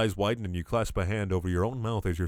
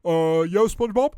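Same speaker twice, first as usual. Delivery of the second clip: higher, louder dopey starfish voice; Patrick voice; 7 semitones higher, 5 dB louder than usual. Acted. Stoned starfish answering his friend, dopey cartoon voice.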